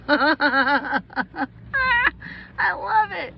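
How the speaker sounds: high, loud laughter breaking into the words; delighted laughing. Real, not acted. Vlogger delighted by a little car, laughing.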